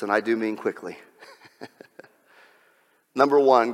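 usual speaking voice

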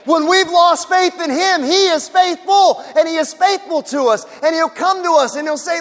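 ringing declaration; preaching at full force. Acted. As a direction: proclaim each faithful like a promise. Preaching climax.

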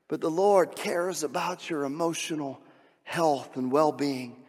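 ordinary talk, nothing marked

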